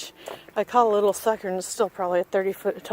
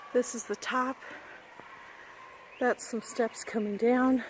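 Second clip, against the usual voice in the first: normal talking voice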